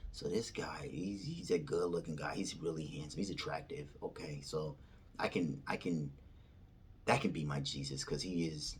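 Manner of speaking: low, hushed musing; soft, private inner monologue; thinking to oneself. Retold or imitated. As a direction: talk yourself into it under your voice. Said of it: "thinking, talking".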